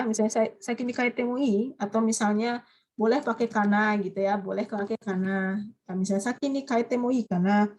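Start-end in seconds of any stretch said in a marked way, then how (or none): none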